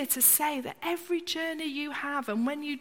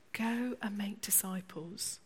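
hushed, near-whispered charge; whispered commission; soft commissioning voice; 5 semitones lower, 9 dB quieter than usual. Acted. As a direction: speak it softly like a sacred charge. Jesus commissioning disciples.

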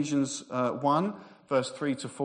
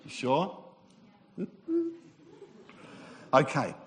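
normal talking voice

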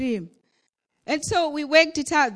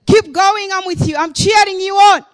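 cheering Father; 2 semitones higher, 9 dB louder than usual. Acted. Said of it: "Your Father this morning is saying".

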